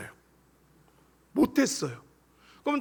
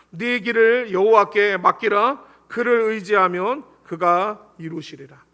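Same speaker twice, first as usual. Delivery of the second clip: slow, emphatic psalm reading, loud at the start; scripture recitation; 5 dB louder, slower than usual. Real, not acted. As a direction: proclaim the verse slowly, word by word. Preacher quotes a psalm aloud.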